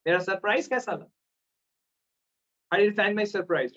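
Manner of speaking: normal talking voice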